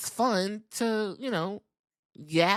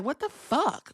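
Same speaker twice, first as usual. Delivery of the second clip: shocked reaction; 9 semitones higher than usual. Retold or imitated. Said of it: "At first, I was like".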